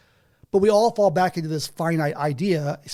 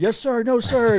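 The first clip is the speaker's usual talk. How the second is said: obedient recruit; 7 semitones higher than usual. Retold or imitated. Drilled recruit answering sergeant.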